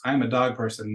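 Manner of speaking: ordinary talk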